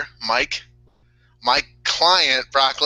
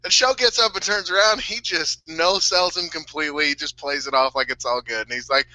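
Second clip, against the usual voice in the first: usual speaking voice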